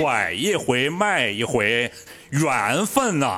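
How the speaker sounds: 慢悠悠的东北腔小品味儿; 学范伟拖长了调子说; 范伟模仿. Retold or imitated. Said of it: "来，来一句。". (拖长腔调学范伟说话)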